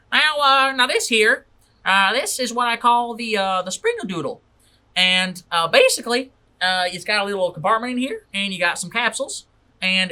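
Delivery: high, loud carnival pitchman voice; showman salesman. Acted.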